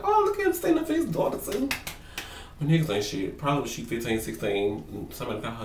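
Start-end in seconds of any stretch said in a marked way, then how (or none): 0.0-1.7 much higher, fast, sing-song sarcasm; fake-impressed squeal, dropping off on too; sarcastic mock praise. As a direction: coo over him in mock admiration. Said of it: "I was like".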